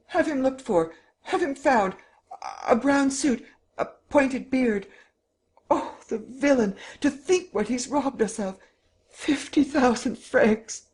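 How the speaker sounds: high-pitched frantic demands; shrill outrage, pushed well up in pitch; shrieking. A narrator's reading. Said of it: "shriek at the top of her voice".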